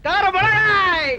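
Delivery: high, slow, drawn-out street cry; long high vendor's chant, falling at the end; hawker's call. Acted. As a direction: stretch the wares into a long call.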